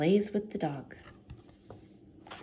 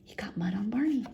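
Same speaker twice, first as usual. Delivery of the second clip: whisper; faster than usual. A narrator's reading. Whispered aside.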